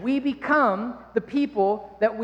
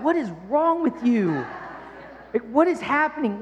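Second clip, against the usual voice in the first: baffled reaction; 3 semitones higher than usual. Retold or imitated. People reacting baffled to his accent.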